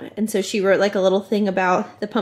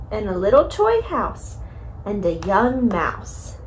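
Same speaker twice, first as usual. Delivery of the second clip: slow, sing-song bedtime reading; reading to a toddler; 3 semitones higher than usual. Acted. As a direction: read it gently to a little one. Reads Goodnight Moon to toddler.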